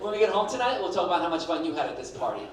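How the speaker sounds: normal talking voice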